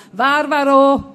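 den Namen mit voller Kraft hinausrufen; gedehnter, hoher Ruf, als riefen alle gemeinsam; lautes Rufen. A narrator's reading. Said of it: "riefen jetzt alle gemeinsam". (lauter Sammelruf über den Hof)

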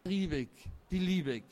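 mildly rapturous, savouring each word; enthusiastic sigh. A narrator's reading. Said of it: "stöhnte da Frau Waller begeistert".

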